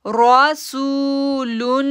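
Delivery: रासूलुन chanted very slowly with a heavy रा and सू held two seconds; slow tajweed chant; drawn-out recitation. Shown as a demonstration. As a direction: hold the सू in रासूलुन. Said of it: "सू को खींचेंगे".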